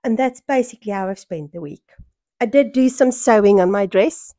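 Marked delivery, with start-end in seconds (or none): none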